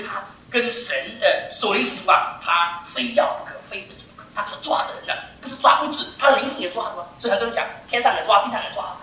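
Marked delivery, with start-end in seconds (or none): none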